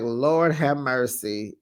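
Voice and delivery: weary sigh. Retold or imitated. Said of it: "And so Dorit is like".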